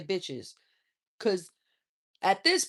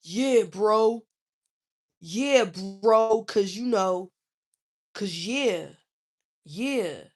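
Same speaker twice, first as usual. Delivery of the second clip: imitating crude guy; slower than usual. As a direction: play a clumsy flirting bro. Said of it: "imitating crude man".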